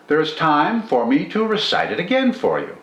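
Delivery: ordinary delivery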